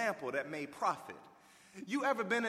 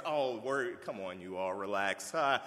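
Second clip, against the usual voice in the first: put-on lower, laid-back voice calming squabbling kids; a hint of a chill Jesus saying relax; easygoing Jesus; 8 semitones lower than usual. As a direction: settle them down like a mellow big brother. Jesus voiced breaking up kids' fight.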